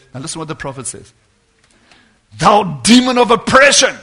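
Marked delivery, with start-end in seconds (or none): 2.4-4.0 raised voice commanding a demon; loud rebuke. Retold or imitated. Preacher retelling the prophet's rebuke, said loudly. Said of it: "he says it loud".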